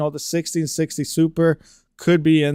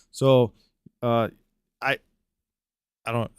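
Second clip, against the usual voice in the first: stumbling over the first words; stuttering; 3 semitones lower than usual. Real, not acted. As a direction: stutter and restart. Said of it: "I'm kinda stuttering now".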